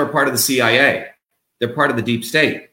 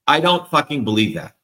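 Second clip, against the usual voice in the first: ordinary talk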